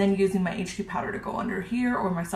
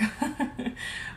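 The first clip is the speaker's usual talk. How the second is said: soft laugh; 6 semitones higher, 6 dB quieter than usual. Real, not acted. Laughs after remark on overwhelming.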